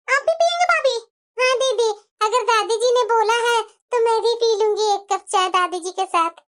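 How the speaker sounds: ordinary talk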